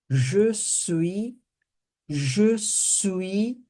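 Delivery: je suis with rounded zhuh and swee; teacher modelling French je suis. Shown as a demonstration. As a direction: model je suis slowly for the class. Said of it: "olha que a pronúncia vem até aqui".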